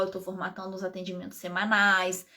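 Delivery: ordinary talk, nothing marked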